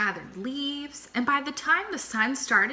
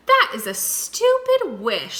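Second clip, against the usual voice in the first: higher, louder, slow scoff; scornful flamingo; 9 semitones higher, 7 dB louder than usual. A narrator's reading. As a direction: sneer at the silly wish. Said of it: "said Flamingo".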